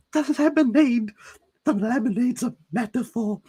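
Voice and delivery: hammed-up eureka; mock revelation. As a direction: exclaim it like a grand discovery. Mocking the book's symbolism.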